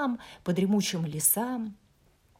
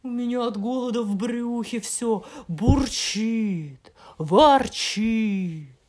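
loud, booming grumbly bear voice; grumbling bear; 3 semitones higher, 11 dB louder, slower than usual. Acted. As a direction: growl it like a hungry bear. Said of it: "медведя-ворчуна". (ворчливый медведь)

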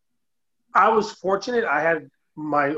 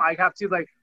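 ordinary talk, nothing marked

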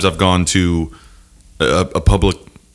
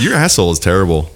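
normal talking voice

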